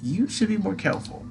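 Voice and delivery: high squeaky moth creature; pixie. Acted. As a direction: tease in a tiny squeaky voice. Atlas voice.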